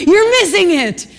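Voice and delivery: high, loud, drawn-out outburst; exasperated cry. Acted. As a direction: cry out at people who don't see. Exasperated cry at oblivious people.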